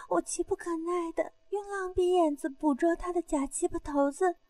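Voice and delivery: ordinary delivery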